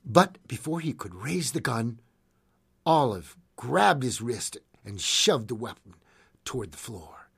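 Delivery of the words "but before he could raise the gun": usual speaking voice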